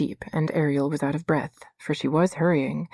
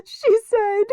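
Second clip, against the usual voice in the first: high, loud and distressed; girl upset, telling her nurse; 6 dB louder, much slower than usual. A narrator's reading. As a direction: blurt it out in distress.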